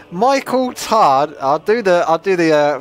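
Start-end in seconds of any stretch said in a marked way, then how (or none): 0.1-1.3 slow, higher Santa reading a name; Father Christmas voice. Acted. Streamer reads a viewer's name as Father Christmas. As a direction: drag the name out like jolly Santa. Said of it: "Father Christmas voice for this little sentence".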